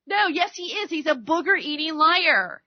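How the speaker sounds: ordinary delivery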